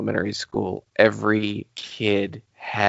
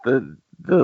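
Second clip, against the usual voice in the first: ordinary talk, nothing marked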